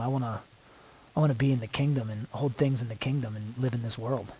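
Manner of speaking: earnest spoken prayer, drifting soft and hushed as it goes on; heartfelt prayer. Real, not acted. Praying to the Lord before the group, intimate and hushed. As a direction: pray it out, pausing to listen.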